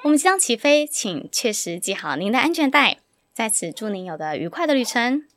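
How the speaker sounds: cabin crew announcement voice; polished in-flight welcome; flight attendant. Pretend.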